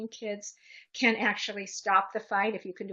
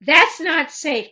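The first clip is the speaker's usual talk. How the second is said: loud, high yell of an adult breaking up a fight; forceful shout, easing into a slow, loud warning; yelled command; 6 semitones higher, 16 dB louder, much slower than usual. Shown as a demonstration. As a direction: yell to halt a fight, then firm and slower.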